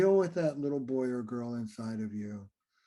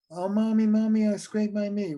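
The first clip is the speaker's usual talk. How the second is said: put-on high, loud little-kid voice; whining hurt child; 6 semitones higher, 5 dB louder than usual. Child calling mommy about a scraped knee, high and plaintive. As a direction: play a child crying out for mommy.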